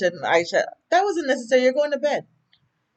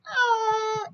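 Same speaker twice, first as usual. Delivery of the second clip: startled gasp. Retold or imitated. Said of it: "he went from, like, to, like".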